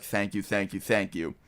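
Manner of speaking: softly repeated thank-yous, hammed-up gratitude on his knees; mock grateful pleading. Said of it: "I was joking".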